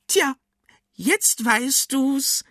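very high, slow witch voice; smug cucumber witch. A narrator's reading. Cucumber witch answering amazed Arthur, smug witch voice. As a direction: draw it out smugly, high and witchy.

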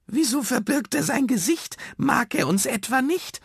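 high, whiny creature voice; plaintive high-pitched sprite; wailing little red dot. A narrator's reading. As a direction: lament it in a tiny high voice. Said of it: "jammerte der rote".

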